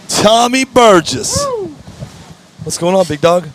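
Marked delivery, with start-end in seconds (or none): 0.1-1.4 high, slow, drawn-out name call; announcer call. Real, not acted. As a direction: introduce him like a ring announcer. Announcing the next guest.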